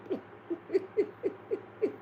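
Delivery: a high airy laugh; breathy high giggle. Real, not acted. Laughing at own story.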